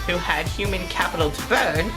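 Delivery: ordinary talk, nothing marked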